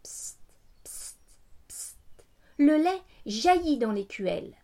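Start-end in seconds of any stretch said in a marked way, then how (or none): 0.0-2.0 whispered squirts. A narrator's reading. Milk squirting sound.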